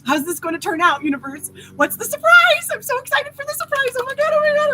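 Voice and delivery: very high, loud, breathless gushing at the universe; over-the-top excitement. Retold or imitated. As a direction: squeal with giddy anticipation. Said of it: "or excitement".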